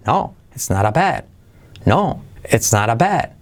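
leading NO, then "it's not a bad" echoes its tune; negative intonation. Shown as a demonstration. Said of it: "Con entonación sería". ("no" primero y "bad" con su misma entonación)

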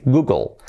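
Google as "GOO-gol"; English pronunciation. Shown as a demonstration. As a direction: Google with a very light final L. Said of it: "Sería así".

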